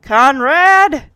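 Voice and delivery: loud, booming summons. Retold or imitated. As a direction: boom it out like an angry vice principal. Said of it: "booming voice".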